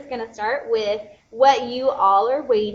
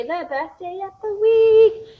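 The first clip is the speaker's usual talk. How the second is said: gushing excitement; 10 semitones higher, 8 dB louder, slower than usual. Real, not acted. Hyping Teen Thursday to viewers.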